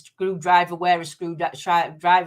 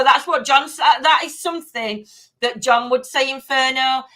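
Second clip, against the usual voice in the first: ordinary talk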